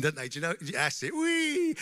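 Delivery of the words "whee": imitating a congregation's high-pitched whee; mock joyful whee, high. Preacher imitating worshippers running in, high whee. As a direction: squeal it up high like people running into the tower. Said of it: "they run in".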